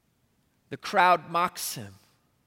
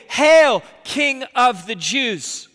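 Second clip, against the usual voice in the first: mock homage; 4 semitones higher, 5 dB louder, slower than usual. Soldiers bow in mock homage.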